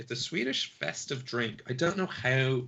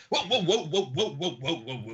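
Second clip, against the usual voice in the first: high, loud startled exclamations, fading down; a startled NPC fading from a yelp to mutters; startled whoa; 6 semitones higher, 4 dB louder than usual. Acted. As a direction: jump back with a flustered whoa.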